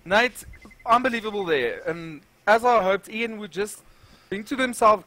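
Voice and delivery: normal talking voice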